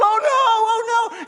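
high loud mock panic shout; panicked shouting. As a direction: yell it like you've seen something awful. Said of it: "he's going".

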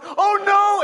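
high, loud mock panic "oh no"; panicked roommate. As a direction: yelp it like a scared roommate. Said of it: "he's going".

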